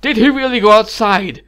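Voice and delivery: loud, high, desperate questioning; frantic demanding. A narrator's reading. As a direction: plead and demand, building to a cry. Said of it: "he said, practically shaking her".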